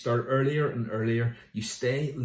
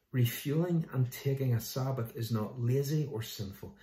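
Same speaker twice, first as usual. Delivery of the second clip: normal talking voice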